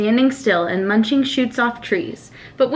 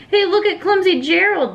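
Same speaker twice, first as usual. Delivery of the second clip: sneering; 7 semitones higher than usual. A narrator's reading. Jungle animals jeer at Gerald, sneering. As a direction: taunt him like a playground bully. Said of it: "the animals all sneered".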